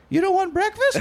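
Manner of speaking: very high put-on female voice; lady's voice. Lady in his shirt to departing man, high-voiced. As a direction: pipe it up as an imitated woman. Retold lady calling after him.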